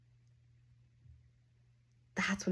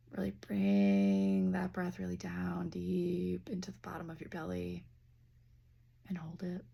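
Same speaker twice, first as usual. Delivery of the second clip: guided breathing. Acted. Leads a breathing exercise.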